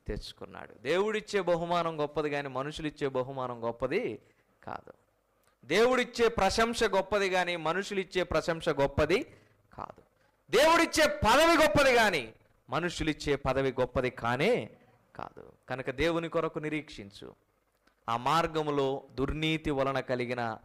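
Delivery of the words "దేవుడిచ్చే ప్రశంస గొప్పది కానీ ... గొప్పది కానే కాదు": higher and louder with each line; rising sermon refrain. Acted. Preacher hammering the lesson home, building louder.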